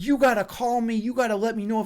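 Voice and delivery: indignant telling-off, acted without full volume; retold screaming. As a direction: imitate an irate boss laying down demands. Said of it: "screaming at me at the top of his lungs".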